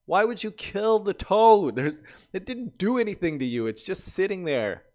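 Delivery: high, loud, indignant outburst; outraged. Real, not acted. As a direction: protest for the poor toad. Outrage at the toad's killing.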